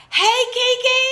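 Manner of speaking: high squealed welcome to a viewer; excited greeting. Real, not acted. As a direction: sing out her name in welcome.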